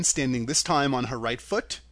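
plain reading voice

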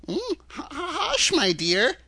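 put-on fussy, faltering old-man voice; nervous little man. A narrator's reading. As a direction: shush her timidly, stuttering. Little man shushing Dorothy.

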